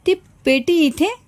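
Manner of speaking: mildly shocked, high-pitched disbelief; startled question. A narrator's reading. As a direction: ask it high, as if caught off guard. Pale mother startled at seeing box. (दचकून, उंच स्वरात विचारलेला प्रश्न)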